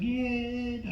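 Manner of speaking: high, soft, drawn-out mock cheer; stretched, quiet crowd yell; imitated crowd. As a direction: mimic a distant crowd cheering, held long. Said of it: "Crowd's like".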